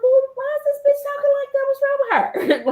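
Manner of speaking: very high, fast, disbelieving; mock-appalled squeal; incredulous outburst. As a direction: react in shocked disbelief. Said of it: "I'm like".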